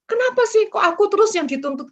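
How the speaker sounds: a high, loud, complaining whine; aggrieved whine. Retold or imitated. The preacher mimics a believer complaining about always being the one corrected, with a high, loud whine. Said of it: "Dan jangan seperti gini".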